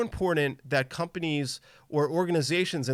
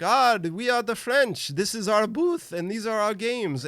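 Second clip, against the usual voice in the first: exaggerated French accent, imitating the exhibitor; horrible French accent; 8 semitones higher than usual. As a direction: ham up a bad French accent, playing the French booth guy. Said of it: "that's my horrible French accent".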